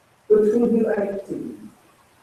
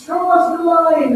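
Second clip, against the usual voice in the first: mock plea for help; 8 semitones higher than usual. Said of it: "they're crying out to Jesus".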